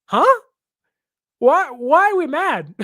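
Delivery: high, loud incredulous "Huh?"; his own reaction to the fans, loud disbelief; baffled surprise. Retold or imitated. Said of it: "my first reaction was".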